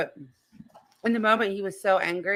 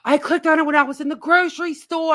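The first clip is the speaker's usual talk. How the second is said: gasp, then higher and louder recall; sudden realization; 7 semitones higher, 6 dB louder than usual. Real, not acted. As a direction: blurt it out as the memory hits. Gasps as she suddenly remembers.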